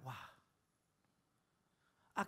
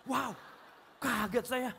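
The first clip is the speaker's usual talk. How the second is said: mock shock; 5 semitones higher, 5 dB louder, slower than usual. Preacher acting his own shock.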